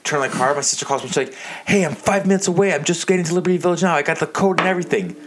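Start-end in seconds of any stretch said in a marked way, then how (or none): 1.7-5.2 sister's voice. Retold or imitated. Sister calling on phone.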